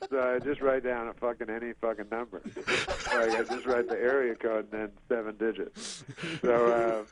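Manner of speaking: ordinary delivery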